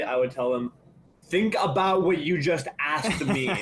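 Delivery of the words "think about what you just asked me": slow, weighted pushback to a boss; deliberate, drawn-out comeback; pointed mock reply. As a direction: spell it out slowly, letting it sink in. Imagined firm answer to boss.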